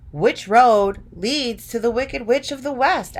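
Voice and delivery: Dorothy asking. A narrator's reading. Dorothy's line in read story.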